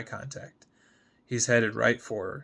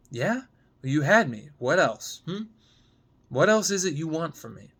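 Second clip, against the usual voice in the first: voiced higher and louder, challenging; heated retort; 5 semitones higher, 4 dB louder than usual. Gemma firing back at Sam on the sidewalk, heated challenge. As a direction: throw it back at him, stung and defiant.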